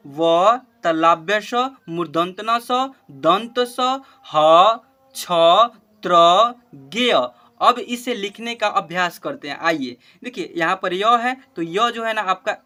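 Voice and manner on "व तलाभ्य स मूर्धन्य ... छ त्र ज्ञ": slow, loud call-out of तलाभ्य स, मूर्धन्य स, दंत स, ह, त्र, ज्ञ; name each letter slowly and distinctly for the children; teacher drilling the last letters; slow letter recital. Shown as a demonstration. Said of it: "पहले उच्चारण करना सीख ले".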